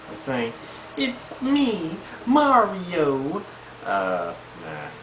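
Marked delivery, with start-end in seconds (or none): 1.0-3.4 much higher cheerful Mario voice; Mario impression. Vlogger tries Mario's greeting, much higher. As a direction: bounce it up like Mario. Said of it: "impersonation of Mario".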